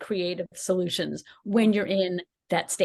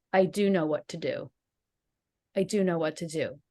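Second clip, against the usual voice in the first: lower, firm, settled repetition; steady resolve; 3 semitones lower than usual. Retold or imitated. After the fight she calms herself with steady resolve. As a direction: say it calmly, as if finding your footing. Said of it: "And I just started saying".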